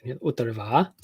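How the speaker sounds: Utarva held low until a rise on "va"; low "Utarva" lifting only on final "va"; late pitch rise. Shown as a demonstration. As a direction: wait till the last syllable of "Utarva" to rise. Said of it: "hear that kind of late rise".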